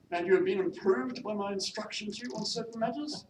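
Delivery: loud, high, commanding lady employer; imperious mistress. Acted. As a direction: summon the maid with haughty authority. Mistress questions maid.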